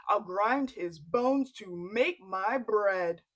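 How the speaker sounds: loud booming giant chant; menacing giant. Acted. Giant sniffing out Jack, loud and booming. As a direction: roar it like a hungry giant.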